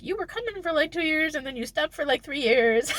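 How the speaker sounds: high-pitched imagined sceptic; mock accusation. Imagined doubter questioning a comeback, mock accusation. Voicing sceptical reaction to her return.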